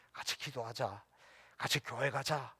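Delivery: soft, low trusting words; gentle reassurance. Retold or imitated. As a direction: speak to the son softly, with faith. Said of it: "기도하는 마음으로 들어주세요". (아들에게 부드럽고 낮게 믿음을 전하는 말투)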